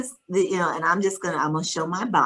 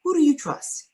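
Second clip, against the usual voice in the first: higher, pointed question from the social worker; social worker's question; 10 semitones higher than usual. Retold or imitated. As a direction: ask it gently but directly, probing. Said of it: "she says".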